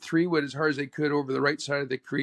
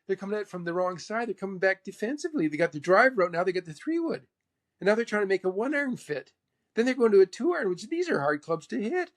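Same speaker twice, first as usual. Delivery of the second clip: higher-pitched mentor impression; 4 semitones higher than usual. Mentor explains others' defensive play, imitated in a higher voice. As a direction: lecture in an imitated, lifted voice. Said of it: "He says".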